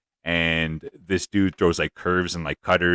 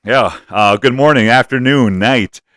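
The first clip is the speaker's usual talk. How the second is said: loud, upbeat show opener; hyped host intro; 4 semitones higher, 11 dB louder than usual. Acted. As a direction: boom it out like a radio host. Hyped podcast opening greeting.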